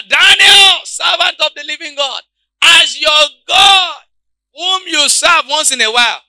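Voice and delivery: high loud anguished call; king's loud lamenting call; lamenting cry. Acted. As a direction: cry out to Daniel in the den. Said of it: "he cried out with a lamenting voice".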